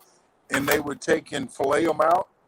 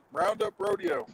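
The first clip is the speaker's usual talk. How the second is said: normal talking voice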